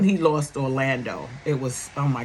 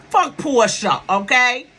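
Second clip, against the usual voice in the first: defiant rant; 8 semitones higher, 6 dB louder, slower than usual. Real, not acted. Vlogger ranting about a TV star.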